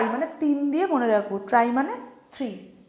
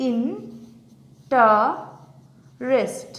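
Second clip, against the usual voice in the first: "Interest" very slowly, long pauses between three syllables; much slower than usual. Shown as a demonstration. Teacher showing how interest breaks up, syllable by syllable. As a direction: isolate each syllable of "interest". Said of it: "আমি ভেঙে দেখাই".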